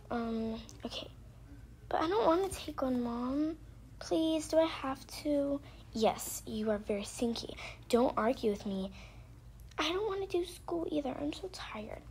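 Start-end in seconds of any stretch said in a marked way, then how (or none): 1.9-5.6 higher-pitched whiny daughter voice; pleading child. Pretend. Daughter begs mom to skip the bath, pleading. 9.8-12.0 put-on high little-girl voice, softening at the end; complaining child. Daughter tells mom she won't do school, complaining.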